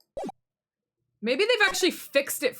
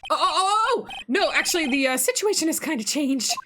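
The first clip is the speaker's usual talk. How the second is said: high, louder burst of acted alarm; flustered backpedaling; 8 semitones higher, 4 dB louder than usual. Lawyer corrects the medium's assumption, flustered. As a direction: blurt it, then scramble to explain.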